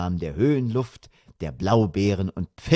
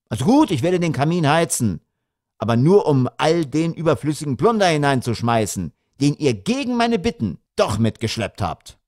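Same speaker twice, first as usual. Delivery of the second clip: raised, heated concession; exasperated father; 6 semitones higher, 4 dB louder than usual. A narrator's reading. Father threatening to burn their luggage, exasperated. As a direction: give in with a threat.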